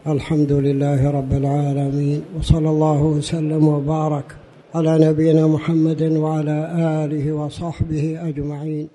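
formal sermon opening invocation; formal standard arabic. Real, not acted. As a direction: recite the opening praise formally. (افتتاحية خطبة بالفصحى)

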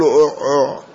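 short "أع، أع" with a u vowel; imitated throaty uʕ-uʕ. Teacher imitates the Prophet using siwak, throaty uʕ-uʕ. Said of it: "أو أن النبي هو الذي يقول".

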